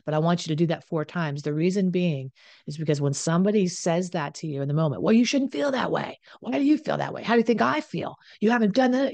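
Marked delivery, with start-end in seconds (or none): 5.0-9.1 higher, mock-indignant partner turning the blame; defensive partner. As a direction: throw it back like the offended partner, pitched up.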